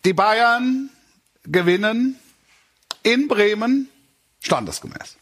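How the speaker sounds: high, slow announcer voice; sports announcer. Acted. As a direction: call the result like a big announcement.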